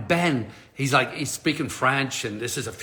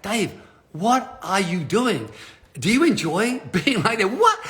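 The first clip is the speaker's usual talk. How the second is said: slow, higher mock telling-off; self-scolding; 4 semitones higher, slower than usual. Dave giving himself a talking to in the corner, mock scolding. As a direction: scold yourself slowly, with a laugh breaking in. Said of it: "give myself a little talking to".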